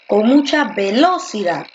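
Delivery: "velocidad" stressed on the second syllable, ve-LO-cidad. Shown as a demonstration. Teacher trying a sample sentence, "velocidad" wrongly stressed on LO. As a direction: lean hard on LO in "velocidad". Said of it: "Porque tú dices".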